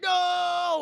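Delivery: high, loud drawn-out no; shouted protest. Retold or imitated. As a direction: yell it as the horrified dad. Father shouts against it.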